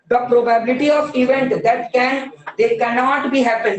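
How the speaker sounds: slow, careful read-out; teacher reading from the textbook. Shown as a demonstration. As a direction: read the question word by word. Teacher reading question aloud.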